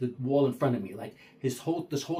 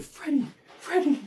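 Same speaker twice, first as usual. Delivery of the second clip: urgent call; 6 dB louder than usual. Retold or imitated. Waking partner in fright.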